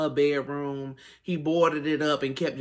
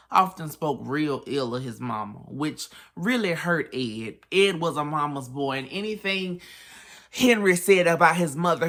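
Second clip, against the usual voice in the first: normal talking voice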